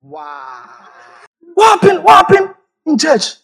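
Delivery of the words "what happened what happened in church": high, loud alarmed cry; shocked shout. Retold or imitated. Man finding smashed eggs, shocked shout. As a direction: yell in disbelief.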